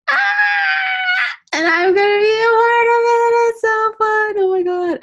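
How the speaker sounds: very high, squealing joy; ecstatic excitement. Real, not acted. Telling the group about joining the app project, ecstatic. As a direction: squeal it out, loud and sing-song, overjoyed. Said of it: "I'm so excited".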